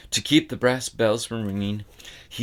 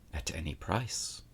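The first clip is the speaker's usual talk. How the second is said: hushed resolve; 5 semitones lower, 11 dB quieter than usual. A narrator's reading. Bastian's secret resolve.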